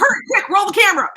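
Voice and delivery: high, loud, rushed call to the crew; excited hurry. Retold or imitated. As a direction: yell it like a frantic film crew. Film crew rushing to shoot.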